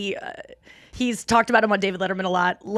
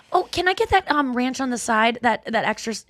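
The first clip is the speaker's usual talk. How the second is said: casual reminder; 3 semitones higher than usual. Retold or imitated. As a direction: ask again like it's nothing. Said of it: "you just casually go".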